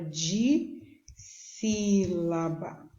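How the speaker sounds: "de sílaba" stretched slowly with a long break after "de"; syllable by syllable. Shown as a demonstration. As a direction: draw out each part of "de sílaba". Teacher splitting the term into syllables.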